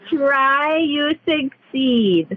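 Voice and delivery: slow, each word given weight; slow emphatic. Shown as a demonstration. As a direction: spell it out slowly. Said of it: "Emphasis on that".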